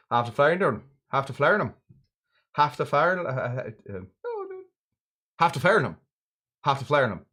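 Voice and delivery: a lightly attempted Barbadian accent, half the falernum run together; self-mocking try at a Barbadian accent; bad Barbadian accent. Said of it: "I'm gonna do my very best, but it's gonna be horrible".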